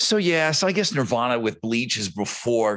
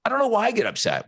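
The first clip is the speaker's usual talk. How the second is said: much higher and faster, worked up; exasperated outburst; 10 semitones higher, much faster than usual. Real, not acted. Podcast host, riled up about the Hall of Fame, exasperated rush. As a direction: blurt it out, exasperated at himself.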